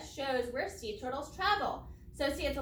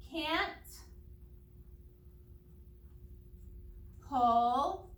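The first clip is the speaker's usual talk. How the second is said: slow dictation; 4 dB quieter, much slower than usual. Shown as a demonstration. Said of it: "make that sound a little bit shorter".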